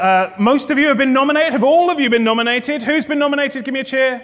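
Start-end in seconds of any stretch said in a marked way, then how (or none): none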